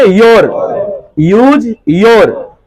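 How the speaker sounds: stretched out syllable by syllable; slow drill. Shown as a demonstration. Teacher slowly drilling यूज योर for the class. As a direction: spell it out slowly for students. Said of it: "teacher drilling the word".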